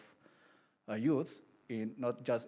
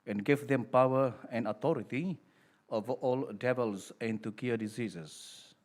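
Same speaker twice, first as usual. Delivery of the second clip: solemn, louder priestly reading; Gospel proclamation; 5 dB louder than usual. Acted. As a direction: proclaim it to the whole congregation.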